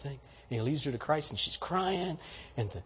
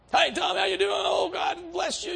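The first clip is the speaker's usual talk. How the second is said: gushing church greeting. Retold or imitated. Churchgoer meeting Tom in the aisle, gushing and high. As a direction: overdo the churchy friend greeting.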